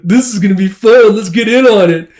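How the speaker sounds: high, loud, rowdy cheer; drunken enthusiasm. Acted. Drunk coworkers joining the interrogation, rowdy enthusiasm. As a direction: whoop it up like a tipsy crowd. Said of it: "coworkers have gotten drunk".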